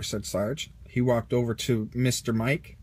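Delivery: ordinary delivery, nothing marked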